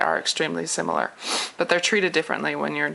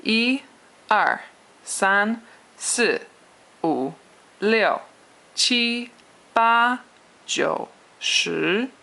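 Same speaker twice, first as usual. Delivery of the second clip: "一", "三", "七", "八" held high and level, "二", "四", "六" falling sharply; exaggerated Mandarin tones; 5 semitones higher, much slower than usual. Shown as a demonstration. Teacher drilling Chinese numbers for learners, exaggerated tones. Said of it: "let me do this one more time".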